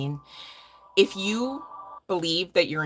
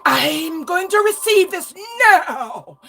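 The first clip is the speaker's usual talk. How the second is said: much higher, louder, very slow and grand; mock grand declaration; 12 semitones higher, 9 dB louder, much slower than usual. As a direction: announce it to the universe like a wizard. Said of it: "To be like".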